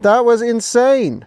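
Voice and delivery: slow, high amazed words; slow awed disbelief. Real, not acted. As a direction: drag it out in awe. Awe after takeoff.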